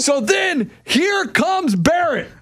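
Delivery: storyteller shouting with glee; hyped-up announcing. Real, not acted. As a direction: announce the arrival like a ring entrance. Building the fight story.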